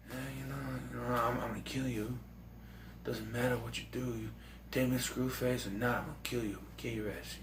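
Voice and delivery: fast, hushed death threats; Seagal whisper. Retold or imitated. Hatcher calmly threatening the gangs, near-whisper. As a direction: rattle off the threats barely voiced. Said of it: "He's like".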